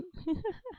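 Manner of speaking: light, high giggle; laugh. Real, not acted. Streamer amused at own answer about accents, soft giggle.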